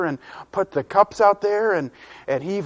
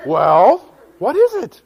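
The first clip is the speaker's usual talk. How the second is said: curious prompting; 7 semitones higher, 8 dB louder, slower than usual. Acted. Parent impatient to hear.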